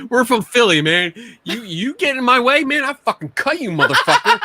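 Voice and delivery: laughing through a mock street threat; mock Philly tough guy. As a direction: play a hammed-up Philly thug. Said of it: "That's what we do down in Philly".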